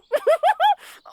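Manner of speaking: high delighted villain cackle; evil cackle. Acted. Villain cackling after soaking his victim, high gleeful cackle. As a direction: cackle at their misfortune.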